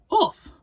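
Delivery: high loud woofs; barking dog. Acted. Reading the story, barking for the dog. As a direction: yap like a little dog.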